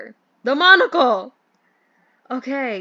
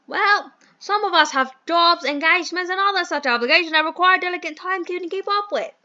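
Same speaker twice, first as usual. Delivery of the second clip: posh British gentleman; 2 semitones higher, 3 dB louder than usual. Acted. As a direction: play the fussy English employer in a mild posh accent. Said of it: "I hate this accent".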